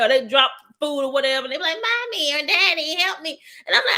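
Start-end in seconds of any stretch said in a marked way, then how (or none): 1.8-3.4 put-on high-pitched child calling for help; pleading little kid. Kids calling parents from the car seat, high pleading voice. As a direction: mimic a small kid calling from the back.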